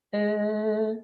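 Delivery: "Eh" as a held "llll", tongue tip resting behind the teeth; sustained L sound. Shown as a demonstration. Speech therapist shows how L is made, held L. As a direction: hold one long steady L in place of "Eh". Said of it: "para hacer la L hacemos así".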